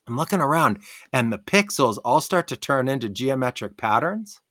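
ordinary talk

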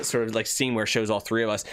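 usual speaking voice

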